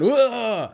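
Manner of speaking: high, loud theatrical whoa; mock flop yelp. Imitating a player exaggerating contact to win a call, high loud yelp. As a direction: sell the contact like a flopping player. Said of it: "Blanchfield went".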